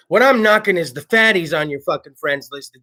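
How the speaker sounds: usual speaking voice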